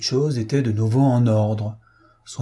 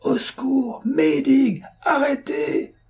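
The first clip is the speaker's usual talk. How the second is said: high, panicked cry for help; terrified cry; 14 semitones higher, slower than usual. A narrator's reading. Fotheringay shrieks at the wall of water, panicked cry. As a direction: scream at the oncoming flood in terror. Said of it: "cria-t-il aux eaux qui accouraient".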